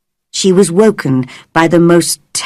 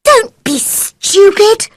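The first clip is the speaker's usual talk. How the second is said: put-on high, slow, cross girl's voice; high, slow, cross retort; Mary snapping. A narrator's reading. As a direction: dismiss his fear impatiently. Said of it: "cried Mary".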